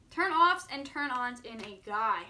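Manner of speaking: normal talking voice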